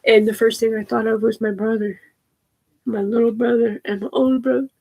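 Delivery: ordinary delivery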